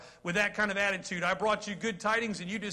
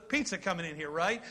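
usual speaking voice

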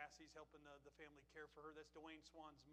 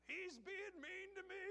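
very high, slow, loud mock whine; loud, high, drawn-out complaint; hollered whine; 5 dB louder, slower than usual. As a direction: tattle to the drive-thru worker. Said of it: "he'd holler in the window".

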